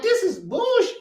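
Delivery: high-pitched outraged yell. Retold or imitated. Venting to the co-host about the coach, outraged high yell. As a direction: blow up at the news. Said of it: "don't understand how upset I am".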